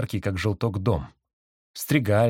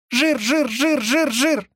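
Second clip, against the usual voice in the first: very high, louder squeaky coo; cooing pigeon; 4 dB louder, much slower than usual. A narrator's reading. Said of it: "закурлыкал голубь".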